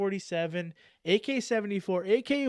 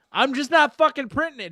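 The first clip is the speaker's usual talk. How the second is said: loud rant; 9 semitones higher, 8 dB louder than usual. Real, not acted. Ranting about shirt prices.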